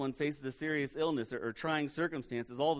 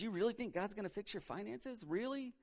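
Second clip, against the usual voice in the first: soft, higher-pitched sceptical murmur; doubting whisper, voiced; 4 semitones higher, 7 dB quieter than usual. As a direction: slip the doubt in very quietly. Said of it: "He just whispers these doubts in your ears".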